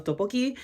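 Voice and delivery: tteokbokki said carefully, attempting Korean sounds; Korean dish name. Real, not acted. Naming her Korean bistro dish, careful attempt. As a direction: try tteokbokki the Korean way, unsure. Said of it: "I apologize if I say this wrong".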